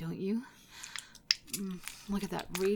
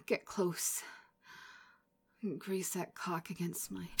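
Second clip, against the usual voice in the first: normal talking voice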